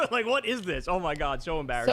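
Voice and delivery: 1 laughing disbelief. Real, not acted. Laughing at clip.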